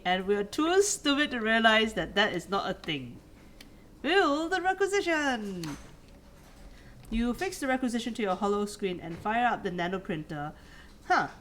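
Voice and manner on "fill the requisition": higher, excited order; rally yourself like a bad idea; mock command.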